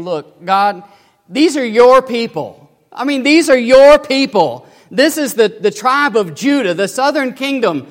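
loud earnest plea to God; Habakkuk urging God about His people; pleading prophet. Acted. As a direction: press the point, these are your people.

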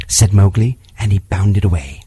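usual speaking voice

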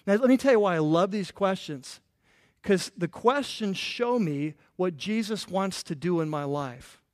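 ordinary talk

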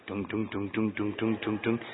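low, soft hummed Jaws bass notes; dun-dun menace like the shark film; Jaws theme hum. Retold or imitated. Humming the shark music to the church crowd, low and soft.

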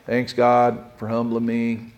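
low, soft, unenthusiastic mumble of thanks; half-hearted thanks. Shown as a demonstration. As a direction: thank God with no excitement at all. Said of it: "different than when you go".

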